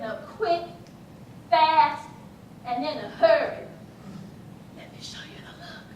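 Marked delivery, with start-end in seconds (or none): 4.8-5.8 low, breathy whispered aside to the audience. Acted. Telling the audience about the look, in a whisper. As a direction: drop to a whisper for the punchline setup. Teller sets up showing the look.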